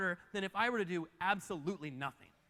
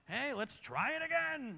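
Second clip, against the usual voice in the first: high, stretched-out mock cheer; mock pep talk; 7 semitones higher, slower than usual. Preacher standing here next year, mock-enthusiastic pep talk. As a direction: draw it out in an overdone chirpy pitch.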